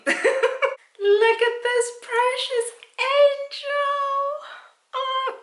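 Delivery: high, slow sing-song coo with a laugh. Real, not acted. Gushing over her favourite plant, high sing-song baby coo.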